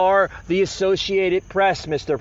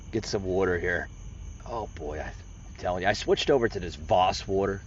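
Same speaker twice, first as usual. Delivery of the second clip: much softer, low, offhand chat; quiet aside; 11 semitones lower, 8 dB quieter than usual. Real, not acted. Vlogger chats about pricey water, hushed. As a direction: drop to a low, close aside.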